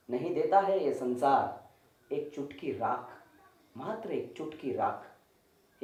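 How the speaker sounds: soft, low, falling away; hushed sorrow. Acted. Storyteller ends the mother's fruitless search, hushed and sorrowful. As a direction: let it sink into a slow hush. Tale's sorrowful closing line.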